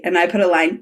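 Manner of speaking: ordinary talk, nothing marked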